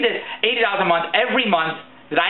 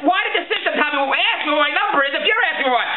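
higher-pitched indignant questioning; exasperated; 7 semitones higher than usual. Acted. Caller questioning the phone agent, exasperated. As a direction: challenge the agent in mounting disbelief.

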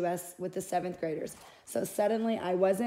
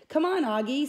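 higher, louder, eager call; eager urging; 7 semitones higher, 5 dB louder than usual. Acted. Sister urging Augie to open the box, eager. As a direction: hurry him along, excited.

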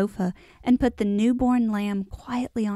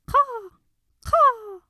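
high, drawn-out crow call; long high-pitched bird cry; crow's caw; 3 dB louder than usual. A narrator's reading. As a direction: imitate a crow cawing. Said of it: "a hoarse caw".

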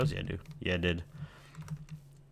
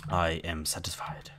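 satisfied whisper; 4 semitones lower, 5 dB quieter than usual. Acted. Content after eating.

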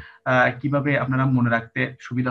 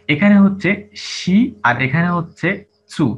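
slow and louder, শি and চু set against each other; contrastive emphasis; 3 semitones higher, 7 dB louder, slower than usual. Shown as a demonstration. Teacher makes students notice শি versus চু, slow and loud. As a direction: lean on শি then on চু, slowly. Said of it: "আমরা একটা জিনিস একটু খেয়াল করি".

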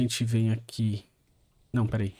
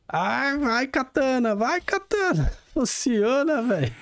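high squeaky pleading; pleading squeal; 12 semitones higher, slower than usual. Real, not acted. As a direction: beg the sword in a squeal. Begging the sword to work.

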